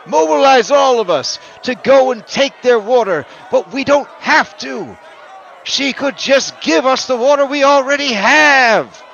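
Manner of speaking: high, loud fiery rally speech; rabble-rousing orator. Acted. Sylph orator warning the crowd about the mayor, high and loud. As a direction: preach to the mob. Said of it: "he says".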